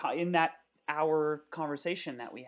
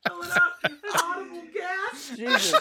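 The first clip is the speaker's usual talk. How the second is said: laughing; 7 dB louder than usual. Real, not acted. Laughing fit.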